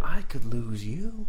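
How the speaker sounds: slow, solemn mock Rick line; drawn out like Rick's grave line; imitating Rick. Friend mimics Rick's line to Michonne, slow and solemn. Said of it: "it was the way that he said it".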